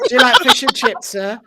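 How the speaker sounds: high, loud British caricature; mock British accent. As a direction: pipe up in a hammed-up British accent.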